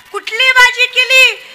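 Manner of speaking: bellowing the question at a deaf wife; loud shout. Retold or imitated. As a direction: yell it into her ear slowly. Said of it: "मोठ्यानं म्हणला".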